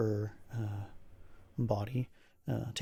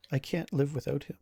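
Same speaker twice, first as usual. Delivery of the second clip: subdued, despairing plea; crumpled in sorrow; 3 semitones higher than usual. Acted. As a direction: say it small and broken-hearted, without shouting. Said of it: "crumples into just a ball of sorrow".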